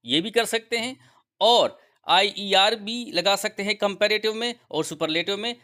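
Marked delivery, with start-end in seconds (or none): none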